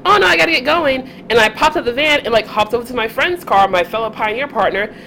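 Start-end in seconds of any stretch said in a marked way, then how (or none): 0.1-1.3 put-on excusing young self. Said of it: "I was just like".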